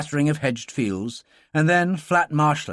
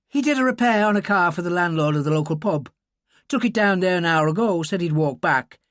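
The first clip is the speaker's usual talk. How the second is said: put-on higher, lively Irish girl chattering; excited Irish girl; 7 semitones higher, 3 dB louder than usual. Girl telling Dylan about her uncle, excited Irish voice. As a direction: tumble out the words, thrilled.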